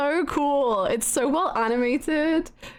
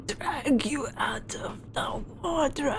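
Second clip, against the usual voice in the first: whispered threat; 2 semitones higher, 6 dB quieter, slower than usual. Acted. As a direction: whisper it, slow and menacing. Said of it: "I'm in character now".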